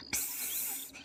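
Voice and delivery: a sharp whispered hiss through the teeth; snake hiss. Acted. The field-owner snake hisses at the thief, an airy hiss.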